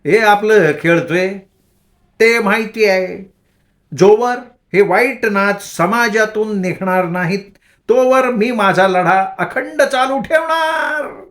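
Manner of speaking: high, slow, preachy crazed voice; mock-wise madwoman. A narrator's reading. Bhagi vowing her crusade to the boys, high and mock-solemn. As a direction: declare it like a mad prophet, slow and high. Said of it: "भागी शहाण्यागत पण वेडेचाळे करत बोलली".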